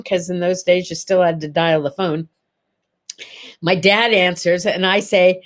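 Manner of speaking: ordinary delivery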